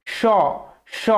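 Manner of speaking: স said as a hushing sh; palatal sh sound demo. Shown as a demonstration. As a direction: hiss স with tongue on the palate. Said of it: "উচ্চারণ করুন". (তালব্য শ-এর মতো স)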